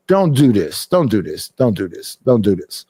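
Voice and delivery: low, falling mock warning; low-pitched, insistent retold caution; repeated warning. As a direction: warn them off in a dropped voice. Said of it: "I be telling them".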